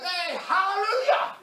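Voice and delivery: loud, high, slow joyful cry of hallelujah; excited hallelujah. Retold or imitated. Preacher on the phone to a friend, an excited joyful shout. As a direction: shout it out with delight and a laugh. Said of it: "getting excited".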